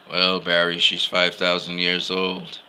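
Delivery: mock flat Cyborg comeback; deadpan Cyborg. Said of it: "how Cyborg just kinda said it".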